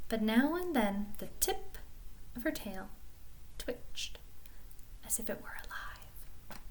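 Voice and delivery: very soft, halting storytelling fading to a whisper; hushed suspense. A narrator's reading. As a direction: creep through it quietly, pausing between phrases. Hushed suspense over the cat's tail.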